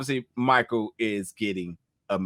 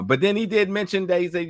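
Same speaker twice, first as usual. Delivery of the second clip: ordinary talk, nothing marked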